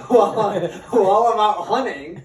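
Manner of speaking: can't hold the laugh while telling it. Real, not acted. Telling friends about the smoking dad, laughing through it.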